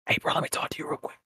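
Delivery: quick, hushed whisper; furtive whisper. Acted. Dealer asking a friend for a private word, quick furtive whisper. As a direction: whisper it fast, pulling someone aside. Pulling someone aside like a dealer.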